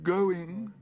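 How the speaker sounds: high, loud desperate call. A narrator's reading. Auctioneer desperately seeks bids. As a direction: plead with the room. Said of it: "said the auctioneer desperately".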